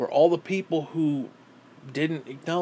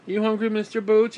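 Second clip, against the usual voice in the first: higher, louder sing-song question; pet talk; 7 semitones higher, 5 dB louder than usual. Real, not acted. Owner asking his dog if he's hungry, high sing-song. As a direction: chirp it at the dog.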